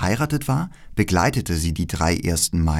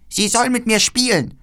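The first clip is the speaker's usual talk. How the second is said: viel höher, fordernd; trotziges Räubermädchen; 14 semitones higher, 3 dB louder than usual. Acted. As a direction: quengelnd wie ein verwöhntes Kind. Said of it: "sagte das kleine Räubermädchen". (Räubermädchen verlangt Gerda zum Spielen: hoch und fordernd)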